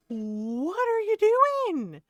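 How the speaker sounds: very high, very slow, stretched out "what are you doing?"; drawn-out "what are you DOING?" in high exasperation; exasperated disbelief. Shown as a demonstration.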